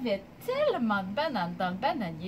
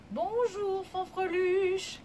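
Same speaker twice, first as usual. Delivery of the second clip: higher, lilting mum voice, drawn out; mum's middle voice; 7 semitones higher, much slower than usual. Shown as a demonstration. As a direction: greet sweetly in a middle-high mum's voice. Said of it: "une belle voix moyenne". (voix de maman, moyenne et chantante)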